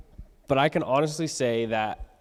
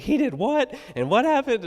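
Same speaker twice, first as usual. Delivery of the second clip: leaps much higher, louder disbelief; a mother hearing bad news, voice shooting up; shocked mom; 13 semitones higher, 4 dB louder than usual. Mom on the phone with the teacher, high shocked disbelief.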